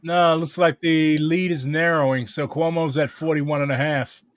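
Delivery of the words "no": ordinary talk, nothing marked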